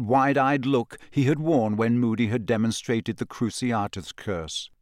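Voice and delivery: ordinary delivery, nothing marked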